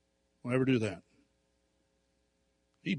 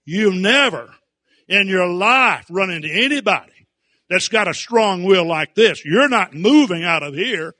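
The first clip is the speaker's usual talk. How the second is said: loud, high, relentless warning; stern loud warning; 6 semitones higher, 9 dB louder than usual. Retold or imitated. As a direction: hammer home that he won't give in. Preacher retells confronting defiant boy.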